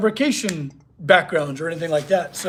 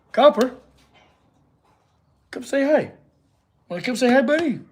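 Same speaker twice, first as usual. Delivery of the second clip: higher, sing-song call to the dog; high dog-coaxing voice; 7 semitones higher than usual. Real, not acted. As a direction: coax the dog over, high and sweet. Calling the dog over.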